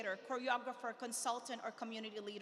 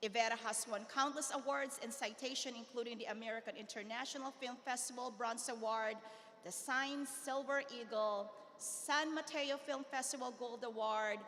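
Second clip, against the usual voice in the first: ordinary talk, nothing marked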